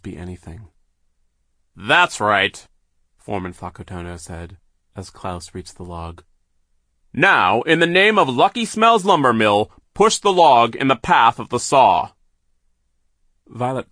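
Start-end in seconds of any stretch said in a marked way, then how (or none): 1.7-2.7 gloating villain. A narrator's reading. Villain foreman voiced in story. 7.1-12.1 loud, high commanding villain. A narrator's reading. Villain foreman commands Klaus.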